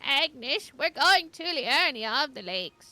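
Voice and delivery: put-on old lady accent. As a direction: call out like a vaguely British granny. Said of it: "whenever I do an old lady accent".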